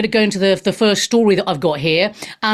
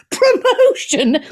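mocking high pitch; 12 semitones higher, slower than usual. Shown as a demonstration.

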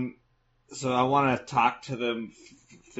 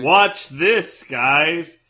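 boastful; 3 semitones higher, 6 dB louder, much slower than usual. Real, not acted. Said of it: "showing off at table".

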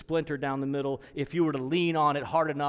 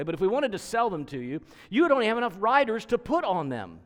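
loud, high-pitched jeer; mocking taunt; 7 semitones higher, 6 dB louder, faster than usual. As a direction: rub their weakness in, louder and higher. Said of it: "He's mocking them".

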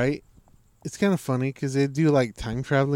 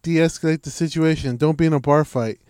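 raised, louder fight advice; loud retold coach; 4 semitones higher, 6 dB louder than usual. Retelling a fighter's bar-fight advice, raised and loud. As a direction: belt out the fighter's tips. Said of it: "He was like".

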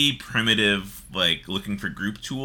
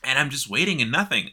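ordinary delivery

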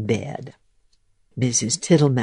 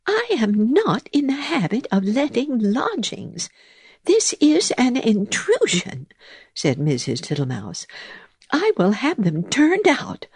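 cross mouse voice; 8 semitones higher than usual. Acted. Mouse cross about bees.

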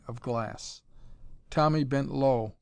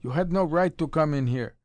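raised, angry voice ordering him out; shouting; 3 semitones higher, 5 dB louder than usual. A narrator's reading. Young man shouting at trespasser Tommy, raised and angry. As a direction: bark it at the intruder.

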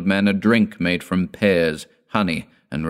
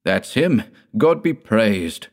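higher-pitched cry of recognition; mildly excited exclamation; 5 semitones higher, 4 dB louder, slower than usual. A narrator's reading. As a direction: call it out high, stretching the praise. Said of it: "cried Stenka, Peter, and their sister-in-law".

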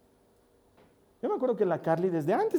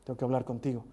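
lower, a hint of a stern father teasing; mock stern father; 8 semitones lower, 7 dB quieter, faster than usual. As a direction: pretend to be serious, lightly. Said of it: "le digo con cara de enojado". (padre falsamente serio, en broma)

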